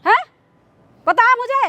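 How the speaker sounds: outraged mother's tirade; scornful, indignant scolding; angry scolding. Acted. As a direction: berate him with indignant questions. Acted mother berates daughter.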